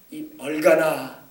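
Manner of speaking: slow, loud scolding; drawn-out insult, exasperated; slow exasperated name-calling. Retold or imitated. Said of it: "이런 말이에요".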